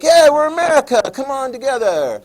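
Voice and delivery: high, loud mock rally cry; rally everyone like a proud crowd; hammed-up patriotic cheer. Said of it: "'Cause it's like".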